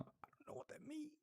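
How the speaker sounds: breathy whisper of confusion; whispered aside. Real, not acted. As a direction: whisper it to yourself, puzzled.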